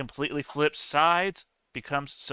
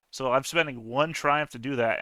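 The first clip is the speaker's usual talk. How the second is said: normal talking voice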